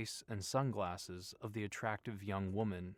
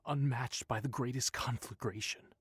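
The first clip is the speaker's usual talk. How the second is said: hushed, dramatic doom-laden voice; a character voice foretelling fire; ominous prophecy; 3 semitones higher, 7 dB louder than usual. Acted. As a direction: intone it like a seer warning of doom. Prophetic warning read in voice.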